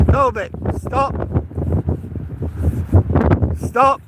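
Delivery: high call to the dog. Real, not acted. Dog runs off.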